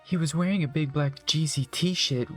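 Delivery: usual speaking voice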